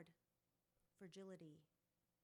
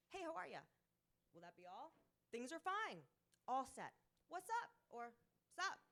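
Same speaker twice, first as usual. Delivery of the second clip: breezy stock phrases; 3 semitones higher, 6 dB louder than usual. Retold or imitated. Quoting stock chatty phrases.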